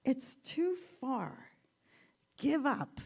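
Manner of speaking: slow, drawn-out give up; slow discouraging frog. Retold or imitated. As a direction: drag it out to discourage. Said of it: "he says".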